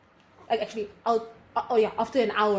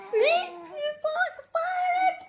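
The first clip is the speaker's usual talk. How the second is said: put-on very high, slow squeaky baby voice; much slower than usual. Voicing the baby to the viewers, very high and slow. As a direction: speak for the baby, squeaky and stretched.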